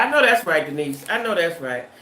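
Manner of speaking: ordinary talk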